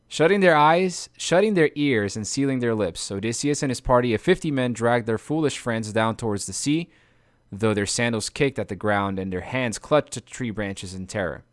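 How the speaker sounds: plain reading voice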